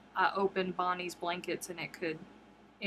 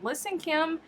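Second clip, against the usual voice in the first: playful scolding; 9 semitones higher than usual. Real, not acted. Playfully addresses a patron.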